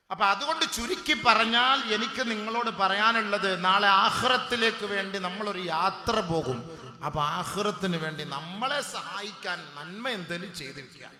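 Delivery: loud, slow, sing-song sermon delivery with chanted Arabic; full-force preaching. Acted. The preacher urges parents to pray for their children, loud chanting sermon. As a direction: preach at full force, stretching each phrase.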